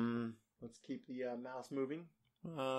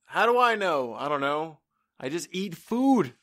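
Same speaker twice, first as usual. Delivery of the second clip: exasperated; 5 semitones higher, 8 dB louder than usual. Retold or imitated. Exasperated answer to the doctor.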